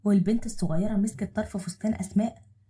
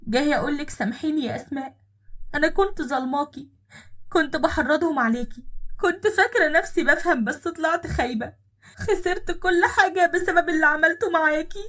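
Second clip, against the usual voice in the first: rising, louder pleading that climbs higher line by line; tearful plea; 8 semitones higher, 4 dB louder than usual. Acted. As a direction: beg for forgiveness, voice climbing. Ex-sister-in-law begs forgiveness at door. (رجاء باكٍ متصاعد)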